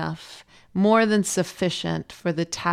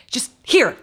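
higher, mildly snappy retold demand; frustrated handover; 6 semitones higher, 4 dB louder than usual. Frustrated mother hands son to husband, mildly exasperated. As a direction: act out thrusting the kid over, a touch fed up. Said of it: "like, thrust the kid at him".

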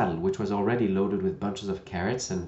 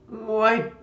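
vexed; 9 semitones higher, 9 dB louder, much slower than usual. Acted. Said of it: "a shade of vexation".

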